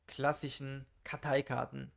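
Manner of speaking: normal talking voice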